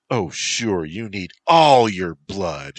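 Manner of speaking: loud, drawn-out shrill protest; shrill it, leaning hard on "all". A narrator's reading. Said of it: "she shrilled".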